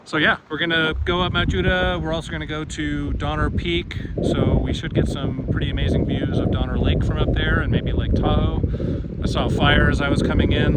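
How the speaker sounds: loud outdoor voice in wind; shouting over wind. Real, not acted. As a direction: push the voice over the gusts. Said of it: "hear me over the wind".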